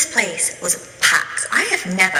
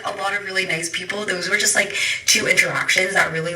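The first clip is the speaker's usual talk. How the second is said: ordinary talk, nothing marked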